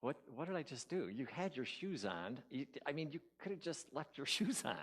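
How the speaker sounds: soft, hurried self-questioning; hushed second-guessing inside your own head; thinking to oneself. Retold or imitated. Blindfolded man regretting his bare feet, thinking to himself. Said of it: "in my own thoughts thinking".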